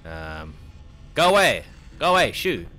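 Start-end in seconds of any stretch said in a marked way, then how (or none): 1.1-2.7 shouting. Real, not acted. Shooing an animatronic away.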